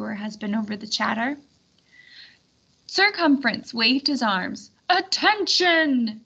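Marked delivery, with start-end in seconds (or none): 4.9-6.2 long drawn-out call for attention; bellowed. Acted. Circumference bellows to the milling crowd, drawn out. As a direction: stretch it out to silence a crowd. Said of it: "he bellowed".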